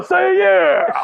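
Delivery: very high, loud carnival hype shout; fair ride operator. Acted. Host imitating the Himalaya guy, rousing riders with a shout. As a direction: demand a yell back from the riders. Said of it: "The guy from the Himalaya?".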